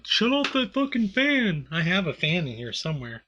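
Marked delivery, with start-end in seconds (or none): 0.0-1.7 high, drawn-out whiny imitation; mocking complainers. Quoting everyone who complained about the fan, mocking. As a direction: mimic housemates griping, pitched way up.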